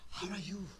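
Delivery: soft, breathy mock greeting, exhaled at the listener; breathing out. Shown as a demonstration. Preacher greeting a friend face to face, breathing out on him. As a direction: puff your breath out on the greeting. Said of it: "Do you breathe out to him".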